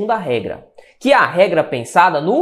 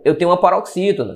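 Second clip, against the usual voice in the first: usual speaking voice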